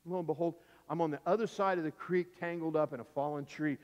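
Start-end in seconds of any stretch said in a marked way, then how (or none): none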